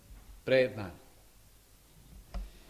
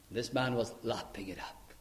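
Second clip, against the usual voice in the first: whisper; 5 semitones lower, 9 dB quieter than usual. Acted. Hushed storytelling aside.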